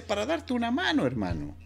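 a hint of an eager fixer. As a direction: jump in keen to help. Javier offering money, voiced.

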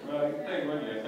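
breaking up with laughter; words squeezed out through giggles; laughing. Real, not acted. Groom laughing mid-speech.

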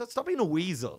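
high, loud mock telling-off; mock scolding. Comic telling-off of a weasel, mock indignant. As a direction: scold them loudly in jest.

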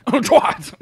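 high, loud fake cough over the word; coughed-out insult. Shown as a demonstration. Covertly calling someone a name, coughed through. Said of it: "also, like, cough through it".

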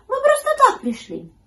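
very high, loud kitten voice; high-pitched little cat. A narrator's reading. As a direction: explain in a piping kitten voice. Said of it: "сказал котёнок".

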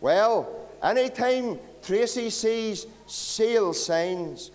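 usual speaking voice

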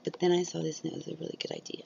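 fast breathy ASMR whisper. Pretend. Vlogger whispering to viewers while demoing ASMR. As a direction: slip into a quick whisper for the viewers.

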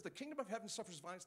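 quick quote. Acted. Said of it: "it says".

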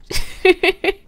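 high, happy giggle; delighted laugh. Real, not acted. Gamer laughing after taming the cat, giggling. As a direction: let a gleeful laugh out.

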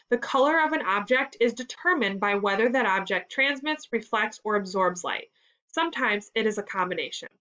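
ordinary delivery, nothing marked

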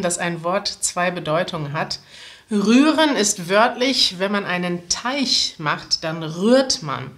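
ordinary talk, nothing marked

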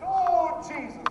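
high, drawn-out cry to Jesus; exclaimed praise. Acted. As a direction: turn the outburst into loud, high praise. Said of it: "I should have said".

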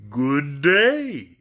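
lightly husky scarecrow greeting; a touch of husk on the greeting. A narrator's reading. Scarecrow greeting Dorothy, lightly husky. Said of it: "in a rather husky voice".

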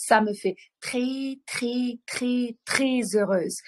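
repeated très rising high, then a whispered très heureuse; drawn-out très. Shown as a demonstration. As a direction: lean on each très, the last one whispered. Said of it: "коли я буду казати так декілька разів". (повторене «très» з наголосом)

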